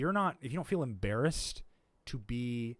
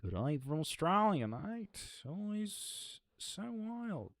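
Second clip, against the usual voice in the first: light Australian drawl, high and stretched; lightly put-on Australian, tune rising on mate; Australian accent; 9 semitones higher, slower than usual. Streamer reading a chat message, in a light Australian accent. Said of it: "the Australian accent card".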